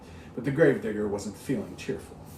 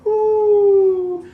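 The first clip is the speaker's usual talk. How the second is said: very high, very slow, louder moaning wail; ghostly wail; 14 dB louder, much slower than usual. Acted. Dead woman's ghost wants her money, high slow wail. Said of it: "The woman cried".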